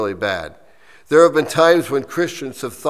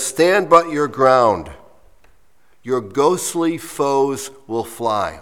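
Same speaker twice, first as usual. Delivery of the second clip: slow, emphatic poem reading; measured recital with raised opening words; 2 semitones higher, 4 dB louder, slower than usual. Real, not acted. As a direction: read it out slowly and deliberately, phrase by phrase. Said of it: "read it to you slowly".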